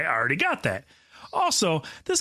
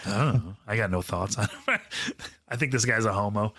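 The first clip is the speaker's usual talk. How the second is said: ordinary delivery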